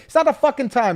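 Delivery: high, loud mock scolding; imitating mom snapping that it's no time for jokes; annoyed mom. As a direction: shut the joke down like an angry mother. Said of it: "and she'd be like".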